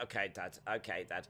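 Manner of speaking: very soft, low, subdued reply; small defeated voice, hammed-up pitiful; meek son. Injured Dominic answering his dad, soft meek voice. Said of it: "And he's like".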